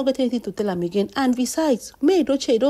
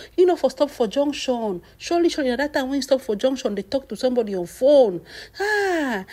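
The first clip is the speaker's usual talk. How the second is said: ordinary talk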